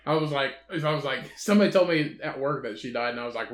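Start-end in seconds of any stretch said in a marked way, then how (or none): none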